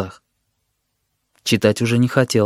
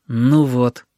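ordinary delivery, nothing marked

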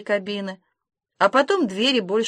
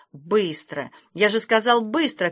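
plain reading voice

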